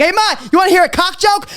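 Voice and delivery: high, eager call to mom. Retold or imitated. Son pitching his mom a joke, calling out. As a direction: pitch it up like you're hollering for your mother. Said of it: "I get up and I'm like".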